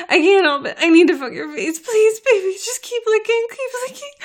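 high, loud urgent pleading; desperate begging. Acted. Begging lover nearing climax.

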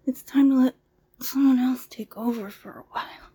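ordinary talk

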